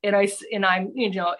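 normal talking voice

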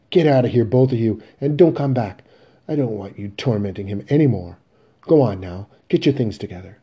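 loud, forceful orders; angry dismissal. Acted. Mother ordering both sons out for tormenting their father, angry.

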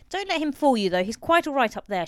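warning. A narrator's reading. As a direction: warn them not to be fooled. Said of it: "she warned".

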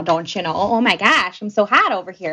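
hammed-up Midwest twang; playful Upper Midwest "don't you know" accent; Midwestern accent. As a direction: put on a folksy Midwestern accent. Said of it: "Sound like I'm from the Midwest now".

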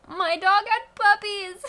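high, loud, drawn-out excited squeal; bursting with puppy excitement; excited announcement. Real, not acted. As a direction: share thrilling news in a gleeful high voice. Excited news about newborn puppies.

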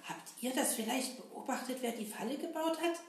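ordinary delivery

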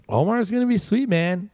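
upbeat. Retold or imitated. Quoting own past remark.

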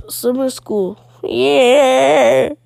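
hammed-up cheer, loud drawn-out yeah; mock excitement. Teasing a kid about summer school, mock excited. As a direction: imitate over-the-top excitement, bursting loud on the last word. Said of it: "what if you was like".

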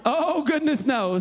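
ordinary delivery

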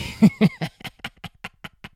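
sudden high laugh that breaks out; real burst of laughter. Real, not acted. Comic laughing at his frog-body comeback, high real laugh. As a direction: crack up at your own joke.

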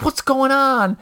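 high, urgent question; alarmed neighbors. Retold or imitated. Neighbors burst in asking, high mock alarm. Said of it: "they're like".

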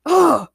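loud, high "ugh"; exasperated groan. A narrator's reading. Villain is fed up with the friends, groaning. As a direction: groan it out in disgust. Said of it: "Ray shouted".

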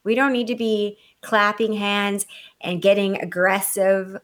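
usual speaking voice

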